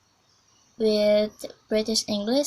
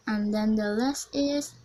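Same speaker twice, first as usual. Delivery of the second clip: ordinary talk, nothing marked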